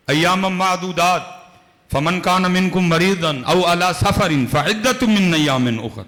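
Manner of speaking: slow, chanted Quranic recitation; measured sacred chant. Acted. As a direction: intone the verse slowly and reverently. Quranic verse recited slowly.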